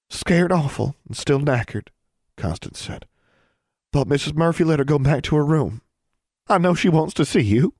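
high lightly voiced young girl. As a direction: speak up as an eager young girl.

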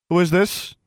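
high, loud demand; sharp challenge to a stranger. A narrator's reading. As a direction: demand it aloud, pitched right up. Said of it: "she demanded aloud".

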